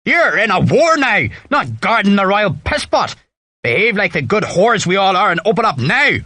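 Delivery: put-on high-pitched sergeant's tirade in Donegal accent; bellowing Irish drill sergeant. A narrator's reading. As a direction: berate the troops in a Donegal accent. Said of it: "he bellowed in his Donegal accent".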